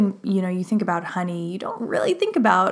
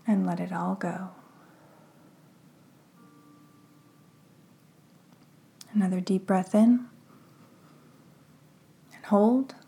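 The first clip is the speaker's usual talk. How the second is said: hushed, unhurried breathing cues; guided meditation. Acted. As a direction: ease the listener into calm, breath by breath.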